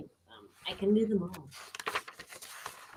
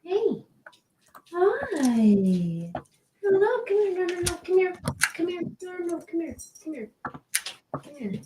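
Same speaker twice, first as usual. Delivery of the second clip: high sing-song pet talk; sweet high voice luring a shy dog; coaxing the dog; 11 semitones higher than usual. Real, not acted. Coaxing the dog toward camera.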